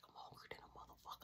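fast, hushed whisper. Retold or imitated. Hungry brother answering at night, fast whisper. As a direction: whisper it quickly.

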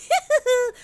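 high teasing little laugh; cheeky high giggle. A narrator's reading. Gingerbread cowboy laughing at the rancher, high giggle. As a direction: giggle up high like a naughty cookie. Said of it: "se rió y dijo".

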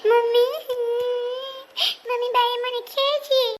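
high, loud cry for mother; tearful child complaint. Acted. Child telling mother about being pulled, tearful cry. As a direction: call out high in a whining cry.

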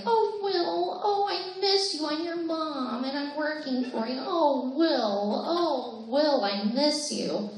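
high, yearning mother calling her son; longing mother voice that sinks toward the end; Winona Ryder impression. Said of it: "sitting through my impersonations".

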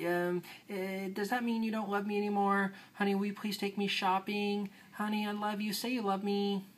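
put-on high, pleading, needy girlfriend voice; whiny ex-girlfriend. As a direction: beg for attention like a clingy ex. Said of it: "that's kind of the whiny ex-girlfriend there".

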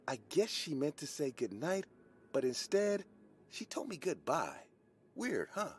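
high-pitched patient, puzzled; patient Corey. A narrator's reading. Patient puzzles over the girl's goodbye, in a high voice.

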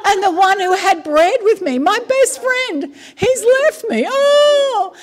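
high, hammed-up weepy wail; David. King David lamenting his best friend's betrayal, mock sobbing. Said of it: "David is having the biggest whinge".

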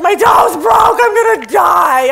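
loud, high mock wailing; melodramatic whiner. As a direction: ham up a tiny injury as a tragedy. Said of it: "some people are just like".

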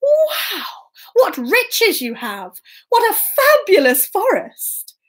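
high, marvelling storybook king; impressed king. Acted. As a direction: swoop up high in royal amazement. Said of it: "the king looked again at the miller's son and said".

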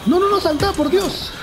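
high, frantic cries while fleeing; panicked yelling. Real, not acted. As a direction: yell it high, running for your life. Chased by monsters, fleeing. (gritos de pánico muy agudos)

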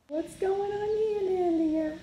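high, slow sing-song voice. Real, not acted. Mother talks to toddler.

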